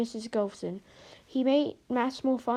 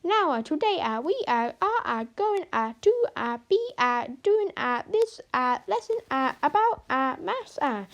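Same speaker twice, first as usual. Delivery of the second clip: higher, louder mock teacher with many uh's; imitating Missus Golson; 4 semitones higher, 3 dB louder than usual. Pupil Harry mocking the maths teacher, hammed-up imitation. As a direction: ham up the teacher's halting speech. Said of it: "trying his best to sound like Missus Golson".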